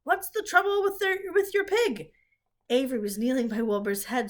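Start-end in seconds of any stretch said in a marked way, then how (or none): 0.0-2.1 higher, louder startled call; announcer suddenly alarmed; alarmed loudspeaker. A narrator's reading. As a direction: shout the worry across the ring. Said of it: "asked the loudspeaker".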